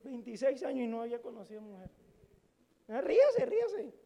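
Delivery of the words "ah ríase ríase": ríase muy alto y fuerte, burlón; risa burlona. Real, not acted. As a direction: carcajada fingida animando a reír. Se burla de su virginidad. (se ríe de sí mismo, alto y fuerte)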